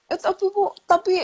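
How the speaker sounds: put-on high, small child's voice; little child protesting. Younger child protesting to mother, high child voice. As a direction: squeak it out like a four-year-old cutting in. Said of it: "yang kecil".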